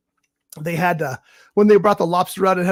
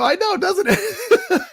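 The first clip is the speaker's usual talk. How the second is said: laughing; 13 semitones higher, much slower than usual. Retold or imitated. Laughing retold reply.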